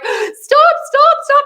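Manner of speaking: high, loud pleading stop; mock panicked plea; desperate stop. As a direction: beg them to stop, squealing. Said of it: "And you're like".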